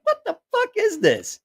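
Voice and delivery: very high, stunned what-the-hell; bewildered outburst. Retold or imitated. Reliving the first sight of the machine, a high stunned squeal. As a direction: squeal it in pure confusion.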